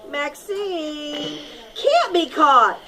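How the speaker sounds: usual speaking voice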